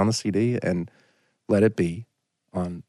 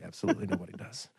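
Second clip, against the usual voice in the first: much softer, quick aside; hushed deadpan agreement; quiet agreement; 13 dB quieter, faster than usual. Retold or imitated. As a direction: murmur it under your breath. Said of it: "I was like".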